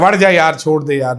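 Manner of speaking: loud, high mock exasperation, then easing off; exasperated plea. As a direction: imitate a fed-up friend begging off. Acting out friend's exasperated phone reply. (झुंझलाकर ऊँची आवाज़ में नक़ल)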